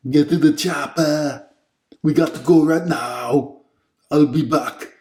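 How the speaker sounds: hammed-up shouting tough guy in a foreign accent; Arnold Schwarzenegger impression. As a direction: bark orders like a muscle-bound movie commando. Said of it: "let's do the Arnold impression".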